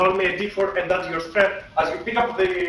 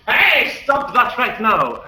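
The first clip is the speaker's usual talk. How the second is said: higher, louder, slow stern shout; barkeeper yelling; 6 semitones higher, 5 dB louder, slower than usual. Acted. Barkeeper shouting at the brawlers, slow stern yell. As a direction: bellow slowly to break up the fight. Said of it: "the barkeeper will yell at this".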